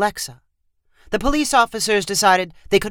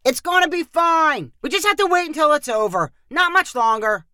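called out; 10 semitones higher than usual. A narrator's reading. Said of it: "Tanner shouted down the hallway".

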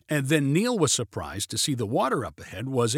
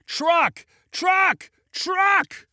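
very high, repeated yell; panicked scream; 3 dB louder, much slower than usual. A narrator's reading. Passenger spots oncoming truck, panicked scream. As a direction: scream it higher each time. Screamed warning before near collision.

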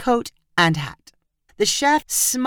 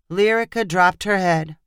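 ordinary delivery, nothing marked

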